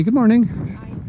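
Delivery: high, bright call to a passerby; cheery greeting. Real, not acted. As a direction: sing out a friendly hello. Greeting a walker with dog.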